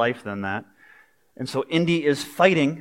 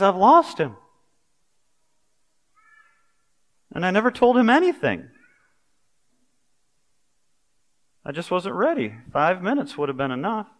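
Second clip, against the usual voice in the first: a touch of a devastated father; grieving father; 4 semitones higher than usual. Acted. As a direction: mourn a lost son, breaking at the end. Indy's dad grieving at cliff.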